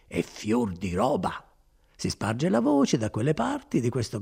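animated storytelling with wide pitch swings; lightly played friar, rambling on proudly; a hint of a chatty monk; lightly voiced garrulous friar. Voices Fra Galdino in dialogue.

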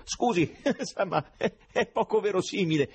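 high-pitched words broken by chuckles; scoffing laughter. A narrator's reading. Listener finds the prophecy absurd, laughing as he objects.

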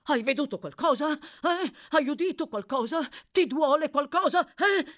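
high, loud volley of anxious questions; extremely agitated. A narrator's reading. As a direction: fire questions at the boy in a fluster. (agitatissimo, concitato)

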